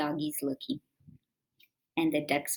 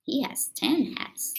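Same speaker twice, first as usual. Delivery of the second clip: whisper; 5 semitones higher than usual. Real, not acted. Reader whispering a side comment to children. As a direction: lean in and whisper it. Aside to kids while reading.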